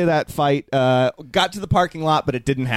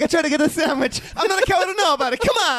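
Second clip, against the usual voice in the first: high-pitched, fast whining plea; mock whiny wrestler; 12 semitones higher, faster than usual. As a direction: squeak it out like a desperate kid begging for food. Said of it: "He's like".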